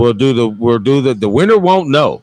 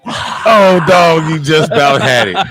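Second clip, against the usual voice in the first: loud high groan at the miss; excited commentator; 3 semitones higher, 4 dB louder than usual. Pretend. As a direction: cry out as the putt lips out. Mock commentary on missed putt.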